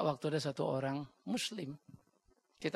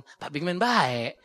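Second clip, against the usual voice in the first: mock praise; 7 dB louder, much slower than usual. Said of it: "baru bilang".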